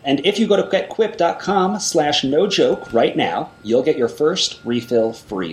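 upbeat ad read with promo code; holiday sale ad. Acted. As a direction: sell the holiday deal.

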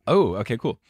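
ordinary talk, nothing marked

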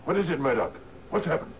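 jolted into alarm; startled. Acted. Captain answering Murdoch's cry, startled and high.

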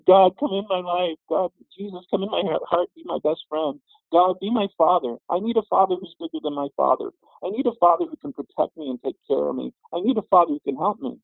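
high-pitched, earnest pleading prayer; crying out to God. Retold or imitated. Boy praying at his bedside for a father, pleading. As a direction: plead upward like a child needing a father. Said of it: "I cried out to God".

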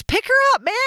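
high, loud shout of a stranger; retold yelling. Drivers shouting from their cars at her friends, a loud high yell. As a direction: bellow it like an impatient driver out the window. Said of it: "People are yelling from their cars".

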